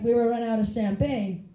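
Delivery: usual speaking voice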